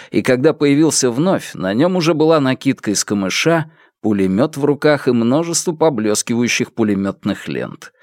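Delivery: ordinary delivery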